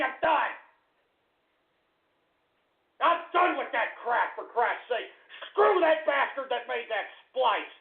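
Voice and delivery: high-pitched, strained tirade; enraged rant. Real, not acted. Host cursing callers who ruined his Friday, enraged. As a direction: explode and rant as if quitting for good. Host rages at pranksters, quits.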